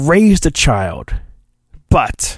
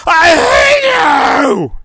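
high screaming I hate you; Anakin impression; 14 semitones higher, 3 dB louder than usual. Anakin shrieking at his old master. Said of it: "[name]'s little line he does perfectly".

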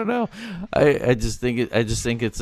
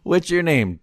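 ordinary talk, nothing marked

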